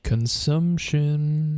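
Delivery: very slow eerie voice; drawn out. Acted. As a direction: stretch it out spookily. Drawn-out spooky echo.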